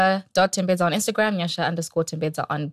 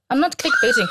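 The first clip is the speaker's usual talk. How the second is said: laughing protest; 8 semitones higher, 4 dB louder than usual. Real, not acted. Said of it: "No, not even".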